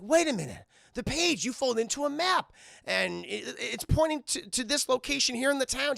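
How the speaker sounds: retold eureka moment; excited discovery. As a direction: piece it together out loud, excitedly. Character discovers the map.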